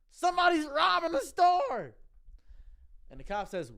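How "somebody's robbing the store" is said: high, loud, slow alarm to the cop; mock panic for the cop outside; alarm call. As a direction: call it out like you need help now.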